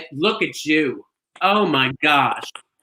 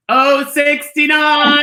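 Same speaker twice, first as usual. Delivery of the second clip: slow, higher, louder cheeky call; excited call; 8 semitones higher, 6 dB louder, much slower than usual. Acted. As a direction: stretch it out with a wink in the voice. Host calls a cheeky number.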